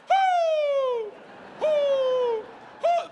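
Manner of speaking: high loud hoots; old man hooting. Retold or imitated. Old man on the far dock hooting, high loud hoots. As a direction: hoot across the lake.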